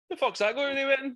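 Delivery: squeaky, mocking disbelief; mock incredulous voice. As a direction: scoff at it in a silly voice. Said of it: "And you're like".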